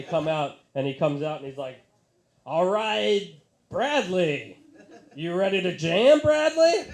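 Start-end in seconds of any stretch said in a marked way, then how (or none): 2.5-6.9 rock singer greeting. Retold or imitated. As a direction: hype up the one guy in the crowd. Singer addresses lone fan.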